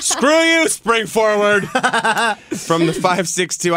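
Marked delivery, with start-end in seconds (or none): 0.0-2.5 mock outburst. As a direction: shout it at the clock. Mock cursing the time change.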